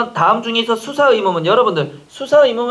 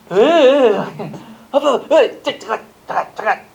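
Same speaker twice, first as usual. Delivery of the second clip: mock recoiling groan; 3 semitones higher, slower than usual. Imitates son recoiling from dad's hug.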